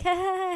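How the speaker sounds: high-pitched put-on "ha ha ha"; fake, chummy laughter way up high; mock social laugh. Kid having fun with the parents, mock laugh. Playing along to charm the parents.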